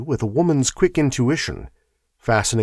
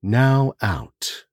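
guided breathing; 3 semitones lower, much slower than usual. Acted. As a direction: ease the listener into calm. Breathing exercise repeated.

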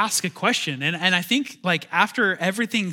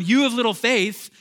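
raised rebuke; 6 semitones higher, 6 dB louder than usual. Retold or imitated. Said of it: "as he's going".

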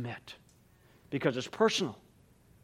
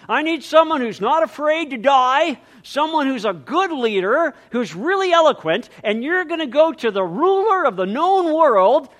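high, loud hammed-up volunteer call; mock recruiter; 9 semitones higher, 7 dB louder than usual. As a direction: pitch it like a carnival barker. Mock God recruiting crowd.

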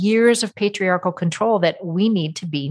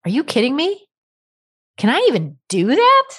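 high, amazed disbelief, rising louder; incredulous disbelief; 8 semitones higher, 4 dB louder than usual. Retold or imitated. Coach's clients reacting to permission, in high incredulous disbelief. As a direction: squeal it like you can't believe you're allowed.

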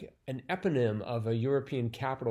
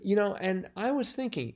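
usual speaking voice

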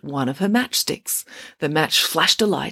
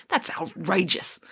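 slow, emphatic outrage, softer; indignant; 5 dB quieter than usual. A narrator's reading. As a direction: draw the word out, appalled. Hannah is indignant.

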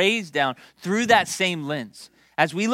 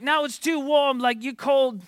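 high-pitched mock rant at moderate volume; hammed-up scolding, pitched up; mock yelling boss; 8 semitones higher than usual. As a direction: imitate a tough boss finding fault. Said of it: "yell at us".

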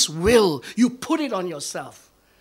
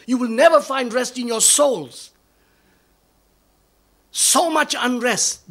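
loud, higher, rising fervour; preaching at full force; 7 semitones higher, 6 dB louder than usual. Acted. Pastor warning the congregation that condemnation is not God, full-force preaching. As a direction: hammer it home to the friends in the pews.

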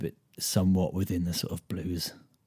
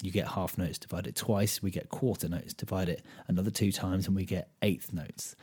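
ordinary talk, nothing marked

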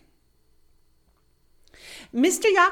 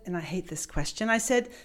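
ordinary talk, nothing marked

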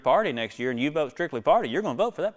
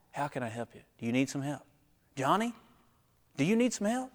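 gentle; 6 dB quieter than usual. Acted. Voicing a caring friend, soft.

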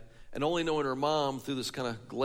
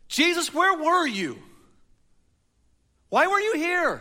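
high, loud, slow cry of reproach; anguished questioning; 13 semitones higher, 7 dB louder than usual. A narrator's reading. Martha questioning Jesus about her dead brother, anguished high cry. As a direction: cry out the why in grief. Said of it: "the questioning in her voice".

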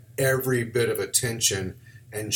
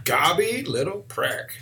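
Gobby slow and higher, "gobby little prick" English-style; English accent try; 6 semitones higher, slower than usual. Shown as a demonstration. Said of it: "You should do it as".

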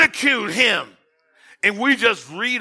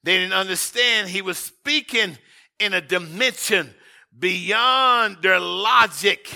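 ordinary talk

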